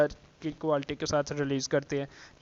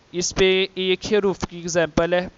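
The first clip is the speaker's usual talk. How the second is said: usual speaking voice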